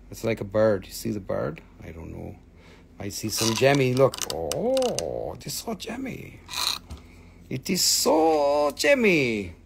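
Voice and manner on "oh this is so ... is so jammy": high, drawn-out gushing delight; slow swooning exclamation. Real, not acted. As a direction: stretch the so in awe. Gushing over stone.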